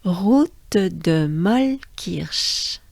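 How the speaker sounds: Route de Mollkirch very slow, French r; very slow model. Shown as a demonstration. Teacher modeling the full place name very slowly. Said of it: "it sounds like this".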